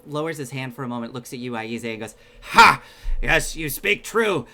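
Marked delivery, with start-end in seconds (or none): 2.4-4.5 loud pained burst, then slow words; wincing in pain. Acted. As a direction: force a laugh and words through pain. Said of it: "is wincing in pain".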